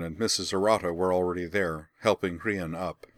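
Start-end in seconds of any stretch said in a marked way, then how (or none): none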